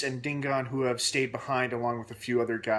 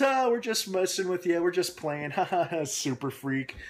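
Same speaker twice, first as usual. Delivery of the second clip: high, teasing mock joviality; laughing it off; 6 semitones higher than usual. Soldiers messing with Ben, laughing it off. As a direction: imitate guys laughing off their jibes. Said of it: "they kind of laugh it off".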